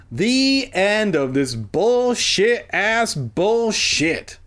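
very slow, high, drawn out; slow, emphatic scornful announcement; exasperated sign-off. Real, not acted. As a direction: stretch every word in mock ceremony. Reader's scornful sign-off to the story.

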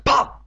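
loud, short popping noise; sucking pop. A narrator's reading. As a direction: burst it out sharply. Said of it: "with a loud sucking pop".

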